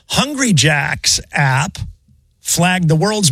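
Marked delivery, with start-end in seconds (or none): 0.1-1.8 Hungry Jack's app, said slowly with weight on Hungry; slow emphatic repeat. Shown as a demonstration. Host repeating the headline slowly for cohosts, deliberate. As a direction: spell it out slowly so they catch it. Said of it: "I'll say it one more time".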